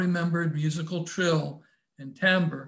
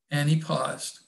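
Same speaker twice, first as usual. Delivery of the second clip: usual speaking voice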